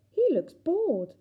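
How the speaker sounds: slow bored drawl. Acted. Tilly describes the bored worm.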